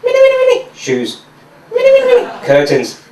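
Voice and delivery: squeaky high mini mini chatter; high-pitched mock chatter; babbling woman. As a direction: squeal the minis way up high, then drop. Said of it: "all I hear is like".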